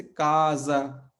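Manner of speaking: काजा said slowly with a z sound, ka-za; slow teacher's demo of काजा with a z; Portuguese pronunciation. Shown as a demonstration. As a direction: stretch काजा out, voicing the s as z. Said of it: "लेकिन यहाँ पे ये का है".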